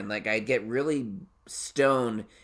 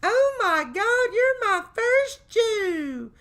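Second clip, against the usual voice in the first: very high, slow, drawn-out squeal; hammed-up amazed local woman, loud and stretched; gushing woman; 5 dB louder, much slower than usual. Woman greeting his wife at her new job, mock gushing squeal. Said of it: "this woman comes up".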